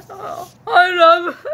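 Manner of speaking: loud, high, drawn-out mock cry; hammed-up tearful wail; pretend wailing. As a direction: wail it slowly, hamming up the heartbreak. Pretend heartbreak in toy story.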